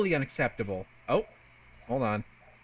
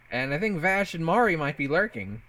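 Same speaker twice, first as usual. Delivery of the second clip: ordinary talk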